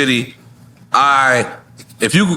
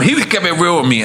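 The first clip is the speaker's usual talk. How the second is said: usual speaking voice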